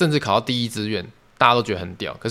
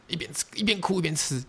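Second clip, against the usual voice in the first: choked up; 6 semitones higher, 7 dB quieter than usual. Real, not acted.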